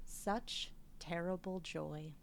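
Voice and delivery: slow, soft and low, savouring each word; hushed awe. Retold or imitated. A critic's hushed three-word review, slow and soft. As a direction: let the three words hang, hushed. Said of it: "this is my three-word review".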